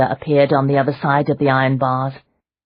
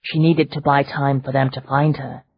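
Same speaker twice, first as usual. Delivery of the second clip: usual speaking voice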